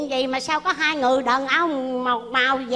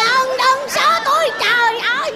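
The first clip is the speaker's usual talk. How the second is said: loud protest; 8 semitones higher, 3 dB louder than usual. Acted. Newcomer telling people not to push, wailing protest. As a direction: cry out against the shoving.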